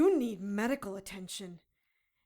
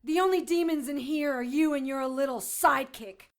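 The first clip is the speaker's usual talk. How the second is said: higher and louder, defiant; angry yell; 5 semitones higher, 8 dB louder than usual. Acted. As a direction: shout him down. Defiant yelling.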